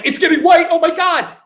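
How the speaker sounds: high-pitched mock horror; mock alarmed outcry. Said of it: "they're just like".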